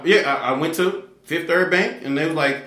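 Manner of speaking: ordinary delivery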